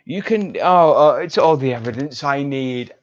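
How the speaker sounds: ordinary talk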